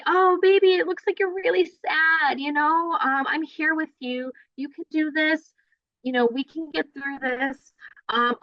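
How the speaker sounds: put-on high, sing-song comforting voice to a crying baby; baby talk. Shown as a demonstration. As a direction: coo reassurance to an upset infant. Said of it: "Then we can say".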